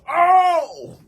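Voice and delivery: long, high, loud "oh"; imitated pain cry. Man hit in the back by vase, high pained cry. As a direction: reenact yelling out from a blow.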